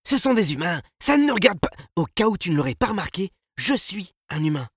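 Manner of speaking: high, loud, indignant retort; agitated protest; heated argument. A narrator's reading. Quarrel among the companions.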